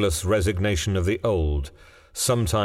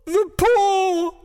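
put-on very high, loud frantic old woman; shrieked, wild realization; wild cry; 5 dB louder than usual. A narrator's reading. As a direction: cry it out wildly. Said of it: "she cried wildly".

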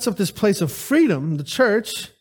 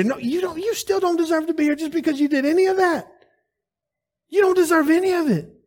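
quick rebuttal; 6 semitones higher, faster than usual. Real, not acted. Rebutting entitled prayer, fast.

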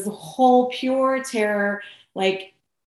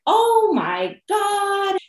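high, drawn-out mock horror; appalled onlookers; 12 semitones higher, much slower than usual. Next riders see the puked-on seat, appalled. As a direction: stretch it out high, imitating disgusted shock.

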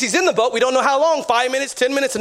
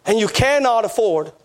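usual speaking voice

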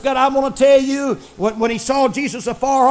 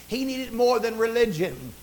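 normal talking voice

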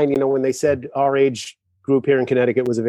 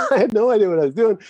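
laughing; 9 semitones higher, faster than usual. Retold or imitated. Laughing at his own panic.

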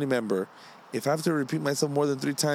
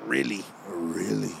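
much lower, slow, drawn-out catchphrase voice; deep mock announcer; 8 semitones lower, 3 dB quieter, slower than usual. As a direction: lower your voice and stretch every really like a cartoon dad.

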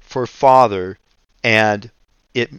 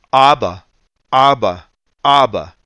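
a preacher imitating a little boy calling his daddy, a bit louder; child crying out; 2 semitones higher, 4 dB louder than usual. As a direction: call out to daddy like a small boy on a plane. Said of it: "he cried out, literally".

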